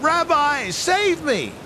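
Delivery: high, urgent call for rescue; crying out for help. Retold or imitated. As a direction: cry out high as if sinking. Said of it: "And I cried out".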